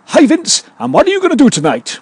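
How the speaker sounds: high-pitched, booming TV judge; talent show judge. Acted. Judge quizzing a talent show contestant, high-pitched showbiz delivery. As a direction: call out to the contestant like a showy judge. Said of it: "when the judge says".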